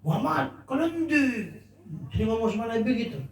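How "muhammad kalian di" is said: higher, raised-voice call at the Prophet; mock the man who raised his voice; imitating a rude, raised voice without real volume; loud rude address. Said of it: "Ngomongnya keras".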